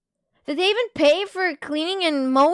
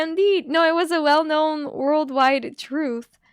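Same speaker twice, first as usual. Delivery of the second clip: normal talking voice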